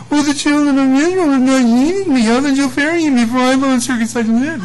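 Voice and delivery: hammed-up Moses making excuses; Moses pleading. Moses pleads his excuse before the Lord, hammed-up plea. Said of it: "now Moses speaks".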